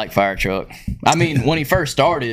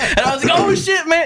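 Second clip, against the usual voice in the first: laughing. Retold or imitated. Retelling his own embarrassed reply.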